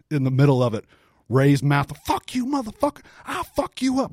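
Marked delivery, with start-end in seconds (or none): 2.1-4.1 high-pitched mock yell, held back in volume; drunken fighter's trash talk, imitated high and strained; imitated angry threats. Ray acted threatening Arlovsky.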